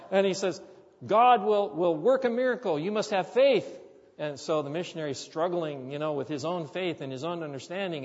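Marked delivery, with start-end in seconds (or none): 1.1-3.7 loud, higher, firm insistence; insistent villager. Retold or imitated. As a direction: press the point home with conviction.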